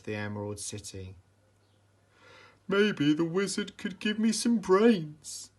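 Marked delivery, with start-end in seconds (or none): 2.7-5.5 higher, louder hopeful scarecrow voice. A narrator's reading. Scarecrow asking Dorothy for brains, hopeful and pitched up. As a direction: wish it aloud, a bit goofy. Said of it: "said the scarecrow hopefully".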